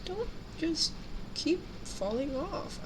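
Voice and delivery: usual speaking voice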